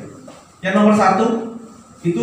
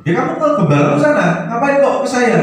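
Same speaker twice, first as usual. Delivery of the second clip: higher-pitched, mock indignant dismissal; imitated brush-off; 8 semitones higher, 3 dB louder than usual. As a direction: act out sending the caller away. Retold brush-off to a caller. (menirukan penolakan ketus)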